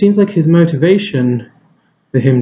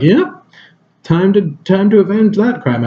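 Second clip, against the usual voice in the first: higher-pitched mock avenger pep; mock-heroic eagerness; 6 semitones higher than usual. Imagining himself avenging old neighbors, mock-heroic. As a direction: pitch it up like an eager pretend hero.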